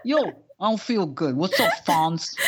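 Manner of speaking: put-on Jersey Shore accent; mock tough-guy greeting and complaint; Scott Baio. Riffing on the impression with a co-host, mock accent. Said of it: "Scott Baio impression".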